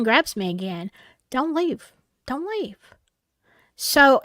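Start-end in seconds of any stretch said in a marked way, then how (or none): 1.3-2.8 soft, urgent repeated warning; an inner voice pleading quietly; hushed inner warning. Retold or imitated. Gut feeling warning inside.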